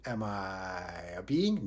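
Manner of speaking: low, very drawn-out question; mock pondering. Teacher plays someone lost in anger, mock pondering. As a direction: stretch the question out slowly, deep down.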